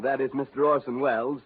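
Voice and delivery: ordinary delivery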